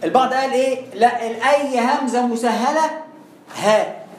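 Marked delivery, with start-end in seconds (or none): none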